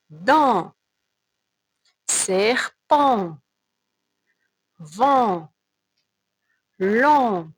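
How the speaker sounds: each word spoken alone, loud and clear, for the class to repeat; répétition lente. Shown as a demonstration. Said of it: "Alors, on répète". (chaque mot dit fort et détaché pour la répétition)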